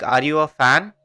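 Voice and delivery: ordinary talk, nothing marked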